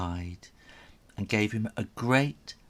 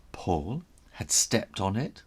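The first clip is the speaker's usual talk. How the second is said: ordinary delivery, nothing marked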